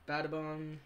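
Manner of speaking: ordinary delivery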